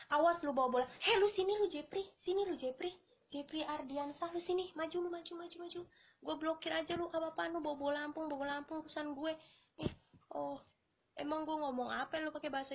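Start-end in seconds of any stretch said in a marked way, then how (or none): 0.1-8.0 mock challenge. Streamer daring a viewer to step up, mock-scolding. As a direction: summon them over for a mock showdown.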